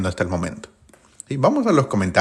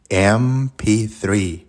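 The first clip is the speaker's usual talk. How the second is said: said aloud very slowly; much slower than usual. Shown as a demonstration. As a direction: stretch out M P three, each sound long. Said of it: "dilo en voz alta". (decir "M P three" muy despacio, alargando cada sonido)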